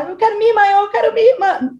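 high imitated plea, in a pleading voice; imitating a desperate sister. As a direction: call for the sister like someone in despair. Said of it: "E aí ela". (imitação aguda e desesperada da irmã)

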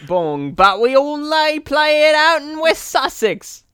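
high, slow mock English accent. Inventing a British name for baseball, in a slow mock English accent. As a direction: drawl it in a posh-ish silly English voice. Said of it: "Now he's from London, England".

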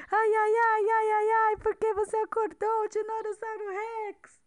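high panicky whimpering voice; scared little dinosaurs. Acted. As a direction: squeak it out in fright. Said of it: "ficaram todos com medo".

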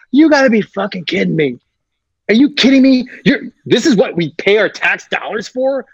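high-pitched indignant rant at the cops; mock outrage. Actor yelling at the cops during the fake arrest, mock outrage. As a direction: play the outraged citizen.

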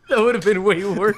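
laughing through the words. Real, not acted. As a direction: say it while laughing. Laughing while talking.

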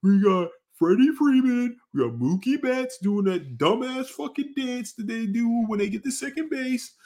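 high, slow, smug fan voice; imitating a cocky Dodgers fan bragging about stars; mocking Dodgers fan. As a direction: brag in a high, drawn-out mocking voice. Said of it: "they're gonna be like".

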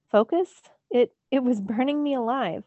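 high, loud disbelieving cry; incredulous outburst. Acted. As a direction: throw the word back at him, outraged. Panicked protest at mentor.

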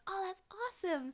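put-on high, soft wonder of a little girl; light, high-pitched kid delight; delighted child. Little girl learning a new word, delighted.

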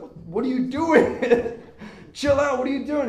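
high, loud, bewildered protest; hammed-up plea to calm down; mock alarm. Counselor to convulsing boy, mock panicked.

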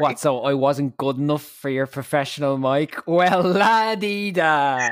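teasing huff, last words stretched high; mock offence. Podcast host teases guest over the unused professional mic, mock-offended. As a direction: play at being snubbed, drawing out the final jibe.

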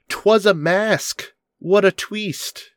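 high, very slow gloating cry; hammy villain reveal. Acted. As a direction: drag out a triumphant villain reveal. Narrator voicing villain reveal.

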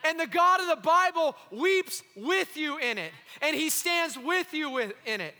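loud, impassioned sermon delivery; full-force preaching. Acted. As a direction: preach it hard to the congregation.

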